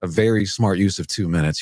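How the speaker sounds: ordinary delivery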